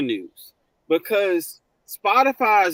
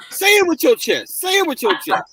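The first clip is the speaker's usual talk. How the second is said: louder, higher playful urging; loud, high-spirited cheerleading; hyped-up exhortation; 8 semitones higher, 6 dB louder than usual. Real, not acted. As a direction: punch it out to egg them on. Playful hype after a joke.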